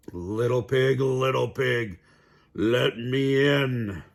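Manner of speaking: slow, coaxing wolf voice at the door; big bad wolf. A narrator's reading. Wolf at the door coaxing the pig, slow and sly. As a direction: lure the pig out sweetly.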